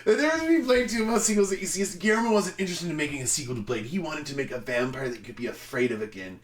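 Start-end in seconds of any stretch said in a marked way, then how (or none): 0.1-3.9 rushed, higher restart; fast restart. Shown as a demonstration. After laughing fit, hurrying through the sentence fast. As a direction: rattle it off quickly.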